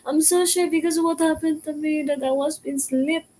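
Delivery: high, tearful sobbing plea; weeping in the break room from embarrassment; crying. Retold or imitated. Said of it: "I'm crying and crying and crying there".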